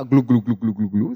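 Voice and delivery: loud, quick glugging noise; imitated gulping. Preacher imitating Peter swallowing water, loud glugging. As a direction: glug like swallowing seawater. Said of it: "cuando abrió la boca".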